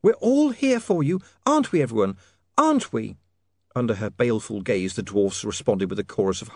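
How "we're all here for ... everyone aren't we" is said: high, pointed woman's prompting; urgent. A narrator's reading. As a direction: prod the others to agree, pitched high. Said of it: "she said urgently".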